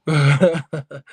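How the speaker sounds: loud real laugh after the bit; loud burst of laughter. Real, not acted.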